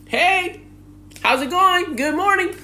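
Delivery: high, sing-song cheery greeting; overly friendly locals. Retold or imitated. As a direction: gush hello like a too-nice stranger.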